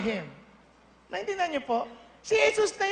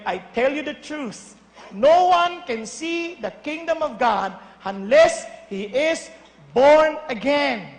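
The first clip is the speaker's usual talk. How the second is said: solemn reading; 4 dB louder, much slower than usual. Acted. Reading Jesus' words solemnly.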